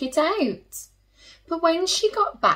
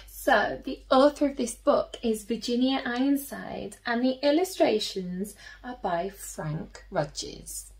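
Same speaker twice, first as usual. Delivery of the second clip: ordinary delivery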